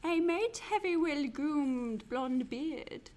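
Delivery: hammy quiz-master wizard. Pretend. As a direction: intone the clue like a wizard, rising toward the threat. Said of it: "I'll do my wizard voice".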